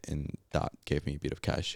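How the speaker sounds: ordinary talk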